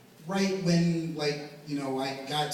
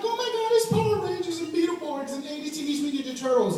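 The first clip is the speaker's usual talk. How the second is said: mock nerd excitement; 12 semitones higher, 5 dB louder than usual. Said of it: "I'm like".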